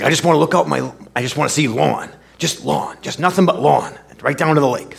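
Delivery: put-on friendly Brooklyn-accented customer, up and quick; [name]'s Brooklyn accent. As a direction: belt it out like a cheerful Brooklyn guy. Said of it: "come out with this great Brooklyn accent".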